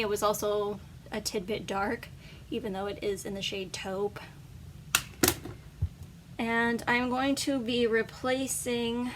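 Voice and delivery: normal talking voice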